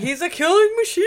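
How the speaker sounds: high, loud hype cry; playful fired-up yell; mock rousing shout. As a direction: holler it like a battle slogan. Co-host cheers the roar on.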